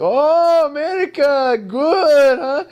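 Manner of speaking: very high, loud mock whoop; imitated cheering. Players react to a second made shot, hammed-up cheering. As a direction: holler impressed praise like the local players.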